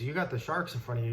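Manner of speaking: ordinary delivery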